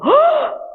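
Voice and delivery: sharp high gasp of shock. Retold or imitated. Family in the stands gasping at the dropped rifle, sharp gasp.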